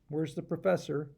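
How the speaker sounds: usual speaking voice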